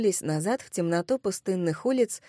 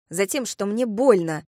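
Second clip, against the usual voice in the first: ordinary delivery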